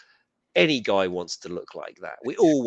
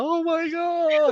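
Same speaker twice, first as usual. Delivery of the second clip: mock swoon, very high and drawn out; enamored swoon; 4 dB louder, much slower than usual. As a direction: gush like a hammed-up lovestruck fan. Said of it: "she's so enamored".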